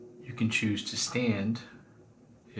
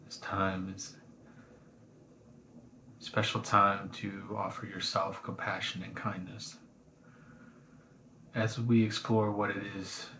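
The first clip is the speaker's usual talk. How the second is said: guided meditation. Acted. Teacher guiding listeners through a body scan, calm meditation voice. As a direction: ease them gently into the body scan.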